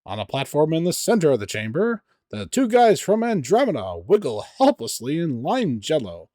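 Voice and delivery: loud, dramatic adventure narration; game narration. A narrator's reading.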